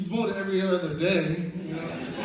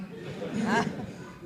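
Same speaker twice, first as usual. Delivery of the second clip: breathy chuckling burst; laughing; 3 semitones higher than usual. Real, not acted. Teller joking about the tire swing, laughing. Laughing at his own story.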